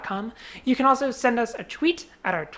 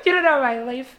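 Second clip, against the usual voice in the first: sassy, higher mock retort; 4 semitones higher than usual. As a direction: toss it off with fake sass.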